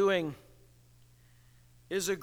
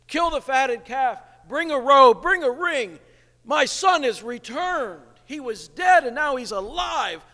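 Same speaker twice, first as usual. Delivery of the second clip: joyful father; 6 semitones higher, 6 dB louder than usual. Retold or imitated. Father welcoming prodigal son.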